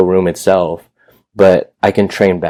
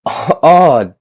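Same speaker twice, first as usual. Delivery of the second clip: retold surprise; 7 semitones higher than usual. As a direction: re-enact being caught off guard, pitch up. Retold surprise at landlord's offer.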